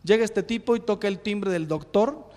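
usual speaking voice

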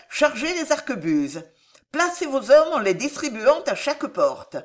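the queen swells into a merciless command. Acted. Queen gives bloody orders. (reine impitoyable qui ordonne le massacre, voix haute et forte)

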